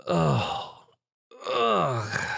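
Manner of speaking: groaning in pain; pained groans. A narrator's reading. Tom groans in pain as he wakes. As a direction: come round groaning. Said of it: "Tom groaned in pain".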